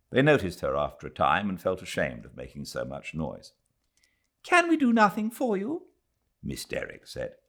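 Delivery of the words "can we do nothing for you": gentle lady's voice. Acted. Miss Derrick asking the invalid friend, concerned high voice. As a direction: lean in with solicitude.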